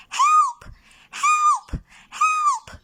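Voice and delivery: very high, loud bird squawk; squawking cry for help. A narrator's reading. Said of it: "she squawked".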